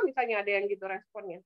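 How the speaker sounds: normal talking voice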